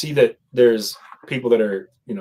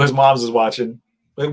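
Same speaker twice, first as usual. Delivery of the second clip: normal talking voice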